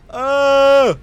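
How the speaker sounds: sudden high, loud cry; startled yelp. Real, not acted. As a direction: caught off guard, yelp it out. Falls or gets hurt in game.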